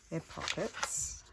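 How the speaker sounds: slow, whispered aside; slow breathy whispered remark; soft whisper. Real, not acted. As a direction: murmur it to yourself, slowly. Slow aside while crafting.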